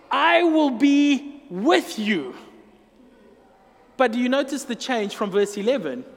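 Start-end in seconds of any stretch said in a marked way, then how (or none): 0.1-2.4 very slow, solemn I will be with you; slow, solemn voice of God, weight on I; slow divine promise. Acted. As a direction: draw out each word as God speaking. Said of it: "Verse sixteen goes".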